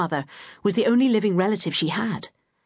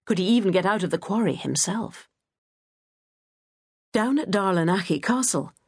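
plain reading voice

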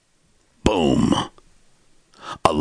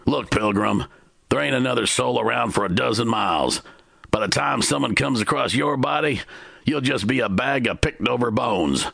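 gunman's order; 8 semitones higher than usual. A narrator's reading. Gunman ordering guns tossed.

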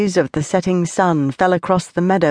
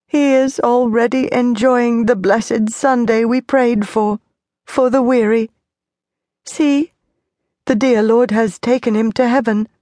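tearful comfort; 5 semitones higher than usual. A narrator's reading. Mother comforting child through grief.

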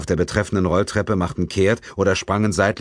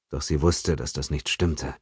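usual speaking voice

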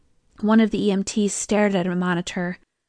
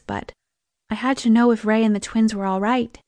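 ordinary delivery, nothing marked

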